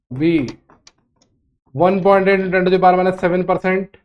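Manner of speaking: slow, evenly spaced question dictation. Real, not acted. Teacher dictating a question for students to write, slow dictation. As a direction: read it out slowly with long pauses for writing.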